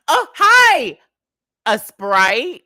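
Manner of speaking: high, loud customer-service greeting; chirpy bartender greeting. Retold or imitated. Bartender greeting a new customer, high and chirpy.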